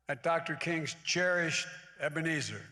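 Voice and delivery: a pulpit-style lean on cherished; stress on cherished. Shown as a demonstration. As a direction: hit the word cherished, Ebenezer after it. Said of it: "emphasize the word cherished".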